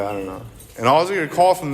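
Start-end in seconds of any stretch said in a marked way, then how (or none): 0.0-0.4 soft, groggy mumble; mumbled. Retold or imitated. Drunk son answering mom, soft groggy mumble. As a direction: barely get it out, half asleep. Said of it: "I'm like".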